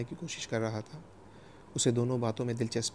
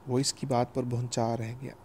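plain reading voice

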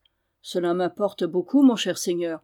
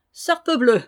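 loud, higher-pitched merry oath; amused exclamation; 4 semitones higher, 5 dB louder than usual. A narrator's reading. Méloir reacts to the Norman's wit, exclaiming merrily. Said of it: "s'écria Méloir en riant".

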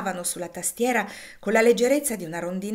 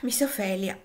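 ordinary delivery, nothing marked

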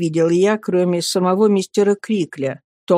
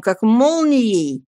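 ordinary delivery

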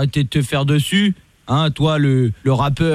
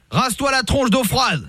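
higher, slow command; mock stern order; 7 semitones higher than usual. Fake cop ordering the rapper to douse his face, mock command. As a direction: bark the order playfully. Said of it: "commissaire Latour".

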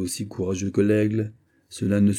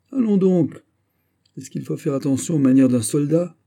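mocking retort; 7 semitones higher, 6 dB louder than usual.

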